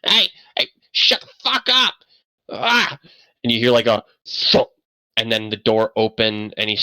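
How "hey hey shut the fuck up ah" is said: much higher, exasperated yell; yelling at dogs. Acted. Old man inside yelling at his barking dogs, exasperated. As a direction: scream at the two barking dogs. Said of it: "and he goes".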